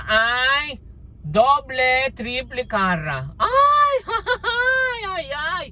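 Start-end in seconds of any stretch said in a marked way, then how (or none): 3.4-4.0 sudden high squeal of surprise; shrill surprised yelp; startled shriek. Real, not acted. Shocked shriek at own joke. 4.1-5.7 high squealing laugh into ay ay ay; giggling ay ay ay; shrieking laugh. Real, not acted.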